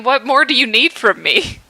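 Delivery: normal talking voice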